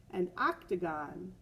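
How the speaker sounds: ordinary delivery, nothing marked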